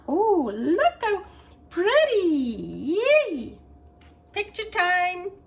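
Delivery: high, gushing delight over the plate. Real, not acted. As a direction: squeal with joy, rising high. Admiring plated food.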